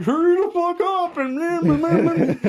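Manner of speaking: high-pitched mocking whine; mocking imitation. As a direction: mimic a pushy nag, trailing into blah-blah. Mocking the man who annoyed them.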